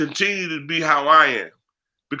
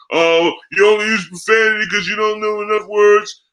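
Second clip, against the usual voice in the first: louder, higher sneering critic voice; mock critic; 5 semitones higher, 4 dB louder than usual. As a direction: mimic the snooty critic with scorn. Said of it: "quoting critics mockingly".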